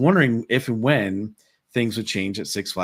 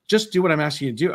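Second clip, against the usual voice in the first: quick, higher-pitched imitation of impatience; retold attitude; 8 semitones higher, 4 dB louder, faster than usual. Imitating the security guard ordering him about, with attitude. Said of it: "what's with the attitude".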